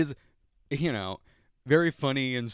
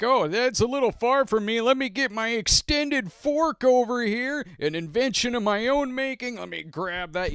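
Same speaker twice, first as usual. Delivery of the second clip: much higher, louder comic host impression; exaggerated show-host bit, pitched way up; Jeff Foxworthy; 13 semitones higher, 4 dB louder than usual. Host cracking corny jokes on a cooking show, hammed-up impression. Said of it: "Jeff Foxworthy as the host".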